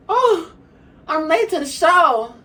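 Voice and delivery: high, slow dramatic whine; mock diva. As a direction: play the tired late diva. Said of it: "she gonna say".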